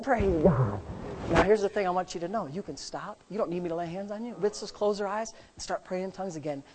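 ordinary talk, nothing marked

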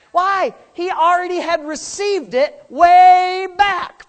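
high, driving preacher's delivery; full-force preaching. Acted. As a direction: preach it hard, punching and stretching key words.

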